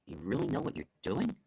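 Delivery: low, soft doubt rising into a question; sarcastic doubt. Shown as a demonstration. Speaker showing how tone flips praise, doubtful question. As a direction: let doubt creep in, lift the last word. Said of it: "What if I said it like this?".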